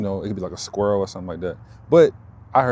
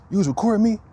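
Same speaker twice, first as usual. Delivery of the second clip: high accusing woman's voice, far higher; mocking accuser; 12 semitones higher, 5 dB louder, faster than usual. Imitating the woman who accused him, high and sharp. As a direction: squeak out her accusation.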